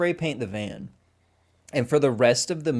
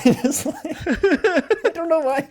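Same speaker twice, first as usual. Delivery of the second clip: laughing; 6 dB louder, slower than usual. Real, not acted. Laughing mid-rant about Franklin.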